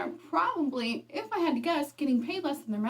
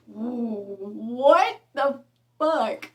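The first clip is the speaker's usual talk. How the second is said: incredulous outrage; 6 dB louder, much slower than usual. Real, not acted. Outraged at sexist boss advice.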